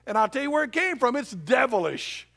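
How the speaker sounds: preaching loud. Retold or imitated. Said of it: "He said".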